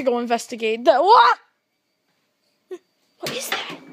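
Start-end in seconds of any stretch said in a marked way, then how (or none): 3.2-3.8 hushed, scared whisper; a nervous whisper in the dark. Acted. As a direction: breathe it so nothing hears. Creeping up on noise.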